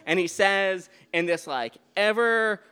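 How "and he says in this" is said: ordinary delivery, nothing marked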